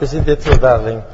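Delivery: ordinary delivery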